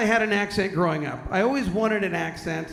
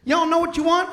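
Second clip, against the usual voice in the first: high, folksy waitress imitation; put-on high waitress voice; 9 semitones higher, 4 dB louder than usual. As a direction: chirp it like a diner waitress. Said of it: "waitress kinda walks up. She goes".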